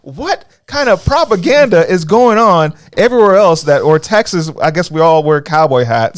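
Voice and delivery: high, loud comic outrage; mock indignation. Acted outraged reply to waitress.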